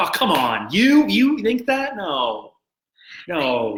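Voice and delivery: incredulous, drawn-out "you think that?"; exasperated, pitch jumping high; exasperated disbelief. Retold or imitated. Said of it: "You're like".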